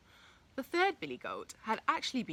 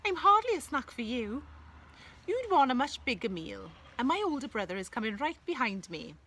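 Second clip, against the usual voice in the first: higher, eager little goat voice; 5 semitones higher than usual. Acted. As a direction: talk your way out, small and quick. Little goat talks to troll.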